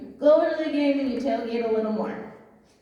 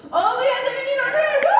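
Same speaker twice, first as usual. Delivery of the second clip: much higher and louder, delighted at the bid; high, loud delighted cheer; thrilled cheer; 12 semitones higher, 5 dB louder than usual. Real, not acted. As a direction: burst out with joy at the bid.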